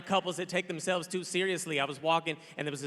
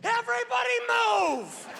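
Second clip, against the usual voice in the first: much higher, slow bellowed order; mock shout; slower than usual. Comic imagines barging into a restroom, shouting. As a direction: clear the restroom with a big shout. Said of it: "and just been like".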